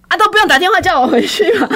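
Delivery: high, fast, laughing through the line; giggling self-mockery; laughing at herself. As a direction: laugh at your own stubbornness as you say it. Self-mocking laughing recap.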